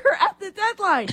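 high, teasing rush; mock urgent plea. Co-host urging the league to find players, mock urgent. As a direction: hurry the teams up, mocking.

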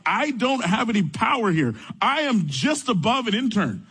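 retold plea of powerlessness, pitched high; high, exasperated self-defense, re-enacted; exasperated protest. Acts out his exasperated protest to complainer.

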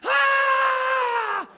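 long high loud shriek; distant scream. Retold or imitated. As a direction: scream out from behind the lockers. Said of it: "we heard another scream".

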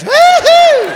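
high, loud woo-hoo; whoop. Real, not acted. Comedian to a Texas crowd, whooping. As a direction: let out a high-pitched cheer. Cheering about being back in Texas.

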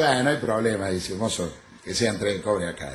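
low, soft acceptance; understated low reply to the squeaky call; unfazed customer. Acted. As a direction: agree quietly, no fuss. Waiter's subdued reply to squeaky call.

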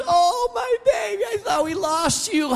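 high, gushing cry of relief; mock overjoyed reunion cry; overjoyed wife. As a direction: squeal like you found a lost sister. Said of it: "She was".

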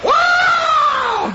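high drawn-out whoa yell; a man clawed at in a treetop; long high yelp of pain. Retold or imitated. John attacked in tree.

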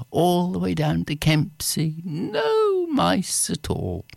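put-on high, building excitement for children; storyteller to children, rising and excited; story narration. A narrator's reading. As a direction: build it up town by town.

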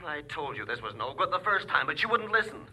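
agitated complaint. Acted. Worked-up caller complains to his superior.

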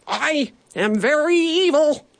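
high, unconvincing evil boast; nervous villain. Acted. Bandit Broccoli tries again, nervous mock-villain announcement. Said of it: "It sounded more nervous than scary".

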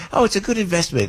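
light take on Mom's line; mother's voice. Retold or imitated. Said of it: "my mom would always go".

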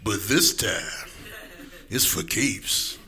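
low, very slow trailer growl; movie trailer voice. Pretend. Mocking the phrase like a movie trailer, in a low slow voice. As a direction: say it like an epic trailer. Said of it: "movie trailer terms".

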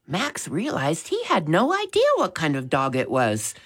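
plain reading voice

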